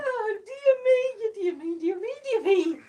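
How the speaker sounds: gasping dear me through laughter; laughing fit. Real, not acted. Wife to camera, teary laughing fit.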